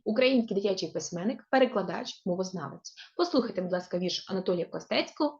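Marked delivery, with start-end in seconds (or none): none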